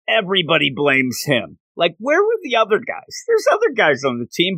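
normal talking voice

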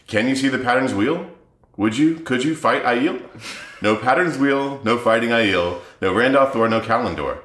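sing-song Seuss-style rhyme recital; lilting storybook cadence; reading a children's book. Acted. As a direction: read it like a silly picture book, bouncing on the rhythm. Reads parody children's book aloud.

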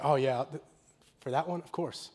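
low, soft, half-murmured assent; quietly voiced tender aside; quiet imagined reply. Jesus's quiet loving reply voiced.

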